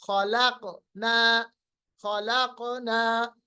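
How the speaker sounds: slow खलकना with the क bouncing; qalqalah demo. Shown as a demonstration. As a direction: stretch खलकना, echo the क in mid-word. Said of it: "ये जो हर्फ कलकला है". (क़लक़ला के साथ धीमा खलकना)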